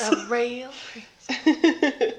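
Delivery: slow, high, regal drawl; grand mock-regal voice. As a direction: say it slowly like royalty. Echoes with put-on voice.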